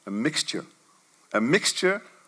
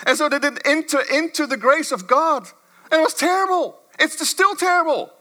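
higher and ringing, hammering each point; full-force preaching; 9 semitones higher, 3 dB louder than usual. Acted. Pastor driving home to his church that unbelief is the sin, raised preaching.

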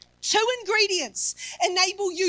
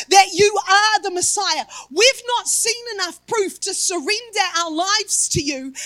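ordinary talk, nothing marked

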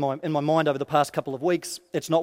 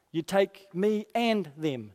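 slow, with "and" stressed between "me" and "them"; deliberate, weighted "and"; 3 dB quieter, slower than usual. Acted. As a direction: insist on the word "and". Moses insisting on both together.

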